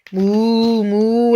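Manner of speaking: long, drawn-out moos; cow moo. A narrator's reading. Reader reviews the cow sound, long moos. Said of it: "like a cow".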